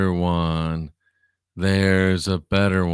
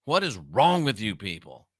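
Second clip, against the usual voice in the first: ordinary talk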